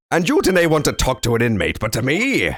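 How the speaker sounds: lightly voiced Irish or Scottish brogue; a hint of a stern Scottish governor. Streamer voicing the hostile governor in an Irish or Scottish accent. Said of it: "some sort of Irish or Scotsman".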